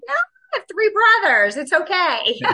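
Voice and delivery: high, loud and laughing; high laughing reassurance. Retold or imitated. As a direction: brush it off with a bright laugh. Retold reassuring boss, laughing.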